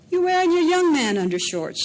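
high, loud teasing cry; delighted teasing. A narrator's reading. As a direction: tease him with delight. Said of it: "she said".

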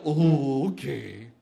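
deep, drawn-out snake voice; gullible python. Acted. Python agrees to be tied, low and slow.

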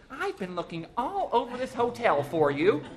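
high, loud, scolding wife; exasperated high-pitched complaint; nagging wife. A narrator's reading.

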